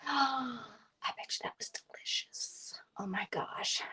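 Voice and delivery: breathless, slow whisper after a gasp; awed little whisper over a treat. Real, not acted. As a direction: hush it like you can hardly believe it. Gasping reaction to peanut brittle.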